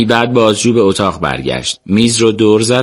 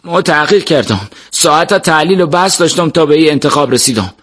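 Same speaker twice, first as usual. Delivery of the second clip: nervous, agitated; 7 semitones higher than usual. A narrator's reading. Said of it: "اسد عصبی سر تکون داد".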